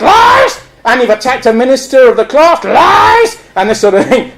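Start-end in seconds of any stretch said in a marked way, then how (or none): 0.0-0.6 high-pitched cry of accusation; high mock shout; acted outcry. Acting out Punch show dialogue. 2.6-3.4 high-pitched cry of accusation; high mock shout; acted outcry. Acting out Punch show dialogue.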